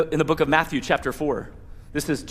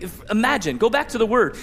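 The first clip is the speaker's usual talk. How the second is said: ordinary talk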